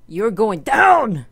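drawn-out, loud playful threat; mock taunt. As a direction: crow it slowly, hitting DOWN loud. Mock threat to a voted-out player.

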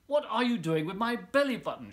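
loud, high outraged shout; boy shouting at the crocodile, loud and high; indignant shout. Acted. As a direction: shout at the thief. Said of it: "shouted the little boy".

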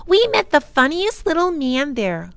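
put-on high, excited little girl; high-pitched eager child telling news; sudden excited recollection. A narrator's reading. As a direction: burst out as it comes back to you. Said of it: "Lindy suddenly remembered".

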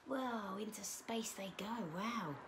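soft, high, awed aside; hushed wonder. Pretend. Reader watches cars fly into space, hushed amazement. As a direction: marvel softly as if watching them fly away.

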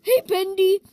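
loud call of "Hey, Bendy". Acted. Character yelling to Bendy as he calls out. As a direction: shout across to him.

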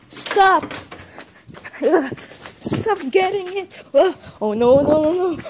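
high, loud frantic cries; panicked shouting. Acted. Clown forcing its way in, panicked. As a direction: yell in panic at an intruder.

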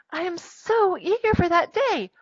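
high, eager storybook voice; Little Mermaid. Acted. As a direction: read it as an eager character, pitched well up. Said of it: "sighed the Little Mermaid".

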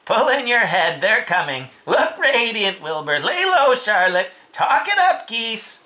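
high, loud rallying calls; rally everyone with high, urgent commands; urgent orders. Acted. Sheep warns the animals they're coming, urgent high voice.

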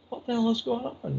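puzzled group reaction. Retold or imitated. Friends wondering about laughter out back, mildly bewildered.

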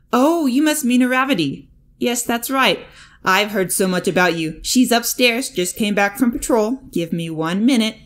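loud, high and jolly; booming friendly mentor; cheerful hero voice. A narrator's reading.

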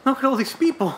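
put-on a panicked kid in a crowd; flustered alarm.

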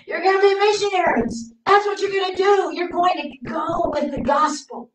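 Jesus sending the disciples out; ringing proclamation. Retold or imitated. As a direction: proclaim it like a calling.

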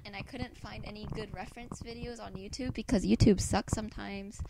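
usual speaking voice

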